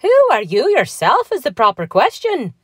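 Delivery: high, loud, swooping comeback; indignant retort. A narrator's reading. Patrick turns Donald's question back on him, indignant retort. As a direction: answer right back, affronted. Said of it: "said Patrick Begorra right back".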